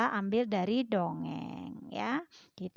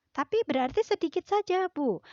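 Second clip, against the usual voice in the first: pupil voice; 9 semitones higher, 4 dB louder than usual. Acted. Teacher voices pupil question.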